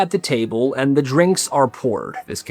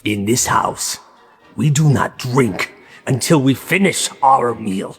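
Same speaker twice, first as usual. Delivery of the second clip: lower, heavier stern dad repeating the rule; a hint of a stern father, now lower and graver; 3 semitones lower than usual. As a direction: say it again, lower and more ominous. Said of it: "he repeats himself".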